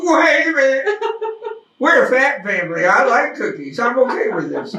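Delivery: high, loud, laughing outburst of relief; laughing excitement. Retold or imitated. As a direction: burst out with delighted laughter. Retold own delighted reaction, laughing.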